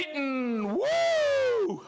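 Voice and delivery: excited cheer. Real, not acted. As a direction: whoop it out high and loud. Cheering a viewer's hometown.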